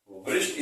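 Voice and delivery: वृष्ट opening on a V sound; wrong pronunciation. Shown as a demonstration. Teacher names the wrong reading, वृष्ट with V before R. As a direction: say वृष्ट, wrongly voicing the silent W. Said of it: "इसका pronunciation बिल्कुल wrong है".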